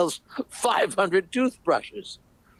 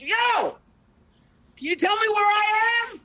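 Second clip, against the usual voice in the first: high call from the balloon; lost balloonist calls to the ground; 9 semitones higher than usual. Acted. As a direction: shout down to the stranger below. Balloonist calling down.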